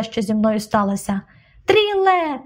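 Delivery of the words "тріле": high drawn-out call of the name; high panicked calling; frightened cry. A narrator's reading. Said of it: "крикнула вона, перелякана".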